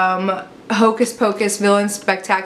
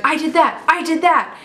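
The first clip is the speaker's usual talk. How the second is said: excited; 8 semitones higher than usual. Retold or imitated. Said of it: "every second she's like".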